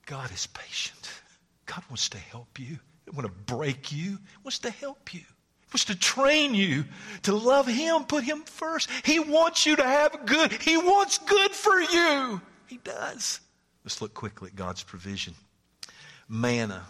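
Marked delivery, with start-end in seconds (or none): 0.1-2.8 whisper. Real, not acted. Preacher softens to reassure. 4.1-13.4 high, slow, pleading comfort; tender reassurance. Real, not acted.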